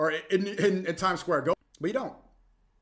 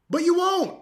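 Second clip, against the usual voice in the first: taunting shout; 12 semitones higher, 7 dB louder, slower than usual. Real, not acted. Taunting repeated challenge.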